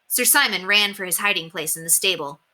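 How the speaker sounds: plain reading voice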